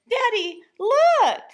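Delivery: put-on high, loud little girl calling out; excited child. A narrator's reading. Little girl calling to her father in the store, high and loud. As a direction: shout it up like an eager kid.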